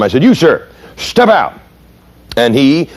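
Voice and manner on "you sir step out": sharp, high, slow command; snapping order. Retold or imitated. Young cop snaps at the driver, sharp and high. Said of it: "I just kinda snapped at him".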